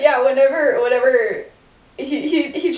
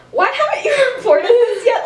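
high, loud, laughing through the question; 11 semitones higher, 4 dB louder than usual. Retold or imitated. Remus quizzing Lily about the deer, laughing. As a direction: crack up mid-question.

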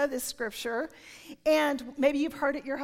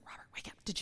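urgent breathless whisper; 12 dB quieter, much faster than usual. Retold or imitated. Wife waking husband at night, whispering. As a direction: hiss it so only he hears.

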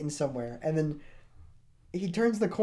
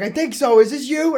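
high and loud, quoting teammates; raised-pitch mock confusion; acted urgent reply; 11 semitones higher, 10 dB louder than usual. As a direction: mimic a frantic back-and-forth. Acted back-and-forth with teammates.

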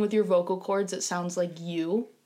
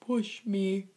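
stiff synthesized-speech imitation; robot voice; 4 dB quieter than usual. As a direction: speak like a talking computer. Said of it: "I can do a robot voice".

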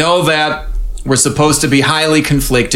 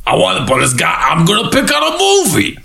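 eager dad voice; 9 semitones higher than usual. Retold or imitated. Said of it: "first he's like".

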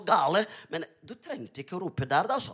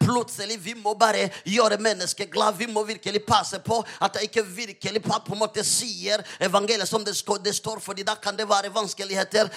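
urgent sermon swelling to loud, higher declarations; fervent preaching, building; 7 semitones higher, 9 dB louder than usual. Real, not acted. Preacher urging believers to speak out, fervent preaching building in force. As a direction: build relentlessly into bold proclamation.

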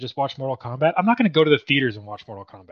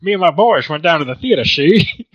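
put-on old-timey gangster voice; 3 semitones higher, 8 dB louder than usual. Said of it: "did you just say went down to see".

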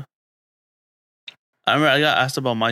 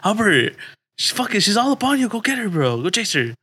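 high-pitched mimicked egging-on; retold urging; 9 semitones higher than usual. Ricky sending Albert after a girl, high excited mimicry. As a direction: urge a friend on, pitched way up. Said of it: "Ricky's like".

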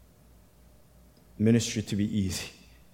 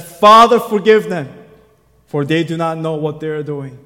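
loud cry; 6 semitones higher, 14 dB louder than usual. Acted. Pastor voices Jesus on cross.

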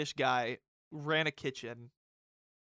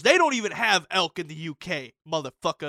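louder mock comeback; retold angry retort; 9 dB louder than usual. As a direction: throw it back like the angry owner. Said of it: "He goes".

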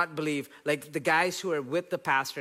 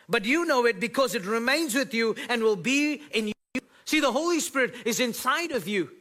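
high, loud preacher's call; fired-up sermon delivery; full-force preaching; 9 semitones higher, 5 dB louder than usual. Acted. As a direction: preach it out to the room, rising.

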